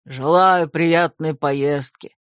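put-on slow hoarse old man's wish. A narrator's reading. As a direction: rasp it out like an old porter. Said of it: "прохрипел он". (хрипло, медленно)

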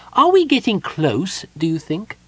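high-pitched small character voice; kender's questioning voice. A narrator's reading. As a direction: ask in a high, impatient voice. Eager kender questions the gnome.

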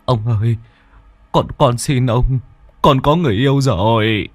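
whimpering plea. Acted. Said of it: "bố mếu máo".

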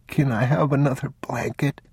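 put-on a small boy feeling ill; sick boy.

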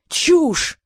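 high, loud outraged yell. Acted. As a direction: yell it out in fury. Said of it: "завопил Сфинкс".

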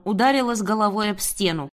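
ordinary delivery

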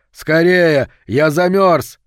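high, loud plea to hurry; urgent shout. A narrator's reading. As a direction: shout to be let in from the cold. Freezing father shouts to be let in.

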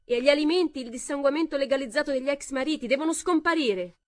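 high, loud rally cry; shouting. A narrator's reading. Connie demanding to the cheering women, loud and high. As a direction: rouse the crowd. Connie addressing applauding crowd.

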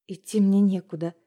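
ordinary delivery

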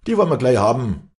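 loud, high, quick taunt; jeering. A narrator's reading. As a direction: jeer confidently as one of the lads. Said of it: "höhnten sie".

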